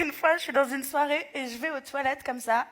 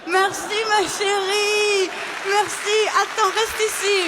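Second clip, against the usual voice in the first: delighted loud thanks; 4 semitones higher, 6 dB louder, much slower than usual. Real, not acted. Delighted by fan's gift.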